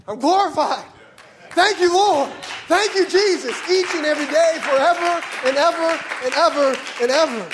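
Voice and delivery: loud, high, ringing praise shout; ecstatic praise. Real, not acted. Preacher shouting thanks to the Lord in ecstatic praise. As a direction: lift it to the Lord at full voice.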